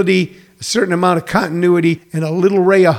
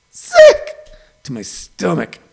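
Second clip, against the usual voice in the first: mock sick; slower than usual. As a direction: ham up the nausea. Disgust at Lakers winning.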